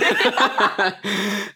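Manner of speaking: short real burst of laughter; hearty laugh. Real, not acted. Both joke about shaving heads. (gargalhada curta)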